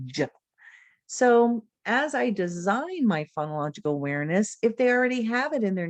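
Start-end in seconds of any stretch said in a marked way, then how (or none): 0.1-0.3 j sound. Shown as a demonstration. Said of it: "that challenging sound".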